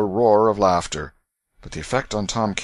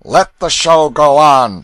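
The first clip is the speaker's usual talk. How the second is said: loud, raised, drawn-out bellow; ruffian's shout; 7 semitones higher, 13 dB louder, slower than usual. A narrator's reading. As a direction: shout it out like a boisterous bully. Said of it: "shouted Canty".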